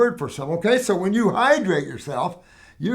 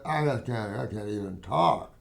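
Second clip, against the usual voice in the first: exhausted client; 8 semitones lower, 6 dB quieter than usual. Retold or imitated. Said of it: "The lady just had to go".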